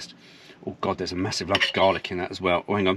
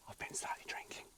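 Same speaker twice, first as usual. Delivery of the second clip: soft whispered confession; 19 dB quieter than usual. Real, not acted. Vlogger confessing to the viewer, cheeky whisper.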